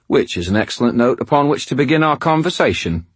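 a Cockney thug savouring the moment; heavy Cockney accent. A narrator's reading. Cockney villain greets the trapped wizard, heavy Cockney accent. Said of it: "a man's voice with a heavy Cockney accent".

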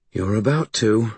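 plain reading voice

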